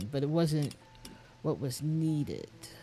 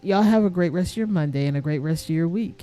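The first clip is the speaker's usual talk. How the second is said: loud sign-off; 8 dB louder than usual. Real, not acted.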